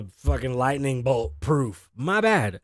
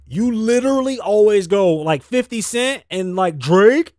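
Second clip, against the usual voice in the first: mocking; 6 semitones higher, 7 dB louder than usual. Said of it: "when y'all think of somebody".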